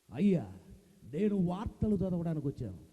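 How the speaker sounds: low, punchy star swagger; Rajinikanth impression. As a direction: state your business with style. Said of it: "super star Rajinikanth గారు ఇట్లే వస్తున్నారు".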